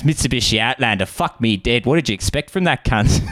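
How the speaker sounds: put-on broad Australian accent, incredulous; Australian taxi driver. Passenger quoting the cabbie on the rival car, broad Australian. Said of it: "the most Australian man".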